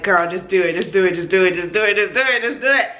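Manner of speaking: rapid repeated chant, building in pitch; insistent hype-up rattle; urgent egging on. Retold or imitated. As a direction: goad her on without a gap. Adrenaline voice egging her on.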